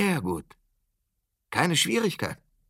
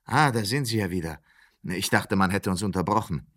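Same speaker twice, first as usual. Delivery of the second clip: normal talking voice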